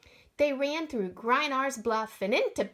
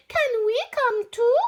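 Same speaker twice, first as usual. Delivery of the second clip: pleading; 11 semitones higher, 8 dB louder than usual. Acted. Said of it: "the two lovely ladies pleaded".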